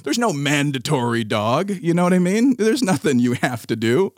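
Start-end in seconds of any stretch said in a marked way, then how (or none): none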